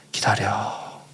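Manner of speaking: low, soft, drawn-out word; God's quiet answer. Retold or imitated. God answering a fuming missionary, low quiet command.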